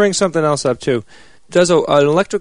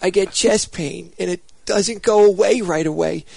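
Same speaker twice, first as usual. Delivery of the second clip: high, odd quoting voice; full character voice for the quote; 4 semitones higher than usual. Retold or imitated. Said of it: "You were only partially successful there".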